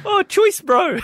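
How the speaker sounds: very high hammed-up Kiwi accent; mock New Zealander, way up in pitch; Boba Fett. Host hinting who it is, high Kiwi accent. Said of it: "'Cause he's a Kiwi".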